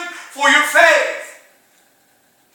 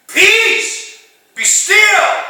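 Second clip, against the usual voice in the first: high, loud command; commanding the storm; 9 semitones higher, 7 dB louder, much slower than usual. Acted. Jesus speaks to the winds, a high loud command. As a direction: order the wind to be silent. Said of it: "he spoke to the winds".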